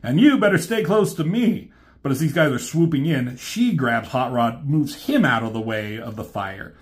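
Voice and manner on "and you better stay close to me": higher, louder cocky retort; cocky Hot Rod. Retold or imitated. Hot Rod flirting back at R C, higher cocky retort. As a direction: toss it back, teasing and sure of yourself. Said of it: "And Hot Rod tells her".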